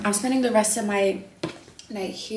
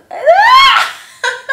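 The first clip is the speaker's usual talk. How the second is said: much higher, louder burst of laughter; 17 dB louder than usual. Real, not acted. Laughing at her own weed confession, loud cackle. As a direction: let out a big cackle.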